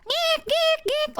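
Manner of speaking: very high repeated screeches; chainsaw teeth grinding. Retold or imitated. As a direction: imitate grinding with piercing squeaks. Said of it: "It's like a chainsaw".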